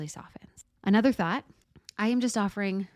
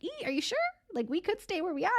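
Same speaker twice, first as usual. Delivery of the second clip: high, soft, doubtful voice; hesitant nervous system; 11 semitones higher, 4 dB quieter, faster than usual. Pretend. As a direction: play the inner voice nervously pulling back. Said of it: "our nervous system's like".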